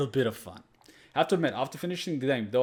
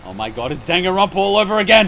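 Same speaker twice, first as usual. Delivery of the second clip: loud excited exclamation; 5 semitones higher, 12 dB louder, faster than usual. Real, not acted. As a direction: cry it out with glee.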